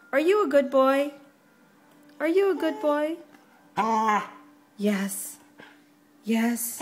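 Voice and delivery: talking to a dog. Real, not acted. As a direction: coo at the puppy excitedly. Owner coos at dog.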